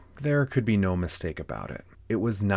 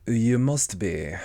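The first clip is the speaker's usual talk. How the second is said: slow, drawn-out cat voice; Cheshire Cat; 3 semitones higher, 5 dB louder, slower than usual. Acted. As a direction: stretch the words out like a leisurely storybook cat. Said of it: "said the cat".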